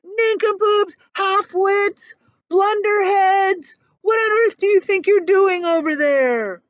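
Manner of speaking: high, loud jeering yell; yelling insults. A narrator's reading. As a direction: shout taunts at the cloud men. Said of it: "was yelling".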